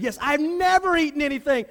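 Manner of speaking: refuse, offended at the thought; indignant protest. Acted. Peter protesting to the Lord, indignant and loud. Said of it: "That's how he would say it".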